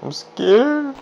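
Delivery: high, squeaky frightened voice; scared squeal. Real, not acted. Alone in his mine at night, high frightened squeak.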